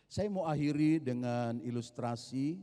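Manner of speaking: ordinary delivery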